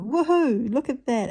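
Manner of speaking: loud, high whoop of joy; loud excited cheer at her own print. Retold or imitated. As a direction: cheer yourself on loudly. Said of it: "and I go".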